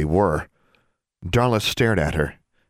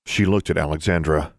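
plain reading voice

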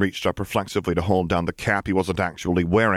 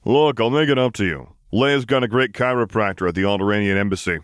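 Solo voice; 4 semitones higher, 4 dB louder than usual. Acted. Solo's voice, coaxing.

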